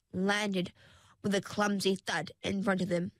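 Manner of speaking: usual speaking voice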